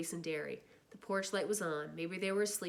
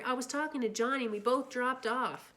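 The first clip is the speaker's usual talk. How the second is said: pleading; 6 semitones higher, 4 dB louder than usual. Acted. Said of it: "I pleaded with Derry".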